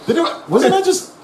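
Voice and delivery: very high-pitched laughing disbelief; mock bewilderment. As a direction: squeal it in comic shock, laughing through it. Acting out his own astonished reaction.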